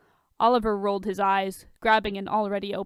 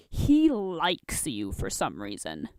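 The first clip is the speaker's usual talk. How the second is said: lower, slow gruff grumble; low gruff Bruce; 5 semitones lower, slower than usual. Acted. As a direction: mutter it like a grudging Batman.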